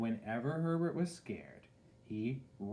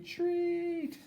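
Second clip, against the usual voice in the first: much higher, louder cry; call to retreat; 4 dB louder than usual. A narrator's reading. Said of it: "said his flag".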